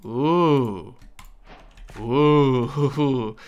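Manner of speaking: loud drawn-out delighted ooh; excited ooh. Real, not acted. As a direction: savour the idea with a big ooh. Just got an idea.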